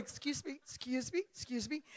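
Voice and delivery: soft, polite little voice; meek retold excuse. Retelling going through the crowd, meek and soft. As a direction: squeeze past people without disturbing them.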